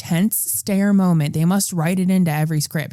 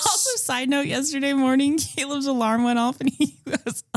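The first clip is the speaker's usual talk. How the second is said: higher-pitched, laughing through the words; 6 semitones higher, 4 dB quieter than usual. Real, not acted. Co-host recalling the alarm mishap, cracking up. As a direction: tell it through real giggles.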